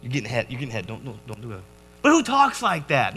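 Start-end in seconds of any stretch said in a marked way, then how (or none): 2.0-3.2 higher-pitched baffled question; worked-up, mock-exasperated wonder; incredulous protest. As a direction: pitch it up in comic disbelief.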